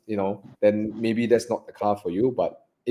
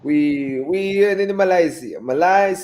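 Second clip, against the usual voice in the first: put-on Italian accent; 8 semitones higher, slower than usual. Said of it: "asking us to do the Italian accent".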